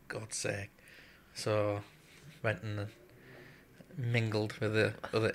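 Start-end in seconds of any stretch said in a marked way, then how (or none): 0.1-0.7 breathy whisper under the breath; whispered aside. Retold or imitated. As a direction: whisper it to yourself, exasperated. Muttered retort, retold.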